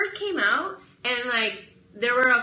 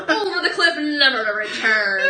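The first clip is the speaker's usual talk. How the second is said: laughing; 6 dB louder than usual. Acted. Mock doom through laughter.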